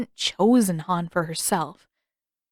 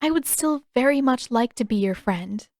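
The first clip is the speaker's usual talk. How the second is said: ordinary delivery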